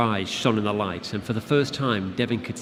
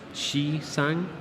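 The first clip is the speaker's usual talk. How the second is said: higher creature voice, curious; 6 semitones higher than usual. Acted. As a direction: ask with eerie curiosity. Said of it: "she asked curiously".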